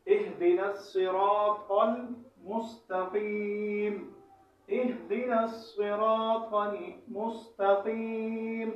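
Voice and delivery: very slow, measured Quranic recitation; correct pronunciation. Shown as a demonstration. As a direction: recite with full heavy letters and long held vowels. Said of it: "اس آیت کا صحیح تلفظ".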